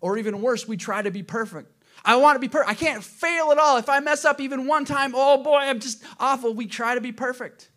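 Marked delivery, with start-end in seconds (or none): 2.0-6.5 high, loud fretful inner voice; mock the panicked perfectionist; anxious perfectionist. Voicing a perfectionist believer.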